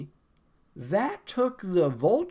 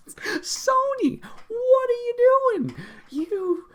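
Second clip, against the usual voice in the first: much higher, louder plea at Sony; exasperated cry; 5 dB louder, slower than usual. Real, not acted. Fan scolding Sony in a high exasperated cry. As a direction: throw it at the studio in despair.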